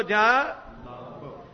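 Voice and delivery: slow, soft, far-off group reply; distant crowd echo. Real, not acted. Congregation repeats the preacher's words back to him, slow and distant. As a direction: answer back from the congregation, drawn out.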